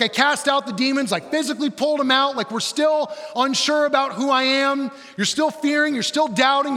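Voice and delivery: high, loud, ranting disbelief; exasperated annoyance. Acted. Jesus berating his doubting disciples, loudly exasperated. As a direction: rail at them, piling up incredulous questions. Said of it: "hear the annoyance in his voice".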